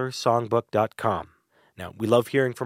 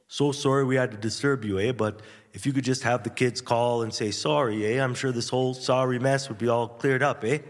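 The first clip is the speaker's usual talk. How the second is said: lightly put-on Canadian accent, apologetic and polite; a mild Canadian policeman, sorry for bothering. Canadian officer politely asking parents for an apology, light Canadian accent. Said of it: "It's the polite Canadian police".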